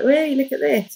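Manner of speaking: doctor proud of his stitching. Retold or imitated. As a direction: call the midwife over proudly. Proud doctor calling midwife.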